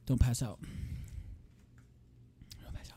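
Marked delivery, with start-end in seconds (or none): 2.6-3.0 whispered warning. Shown as a demonstration. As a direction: breathe the words out unvoiced.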